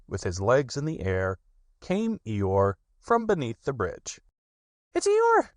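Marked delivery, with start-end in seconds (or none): 4.9-5.5 very high, loud cry; terribly excited. A narrator's reading. Little Roo spots Eeyore floating by, crying out high and excited. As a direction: burst out with the news. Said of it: "cried Roo, terribly excited".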